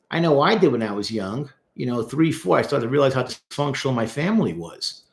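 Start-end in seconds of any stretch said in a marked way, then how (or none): none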